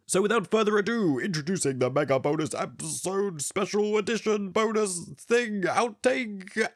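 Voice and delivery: hyped-up announcer. Pretend. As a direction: announce it grandly, stretching the last words. Announcing a bonus outtake episode.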